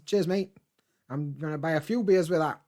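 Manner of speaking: usual speaking voice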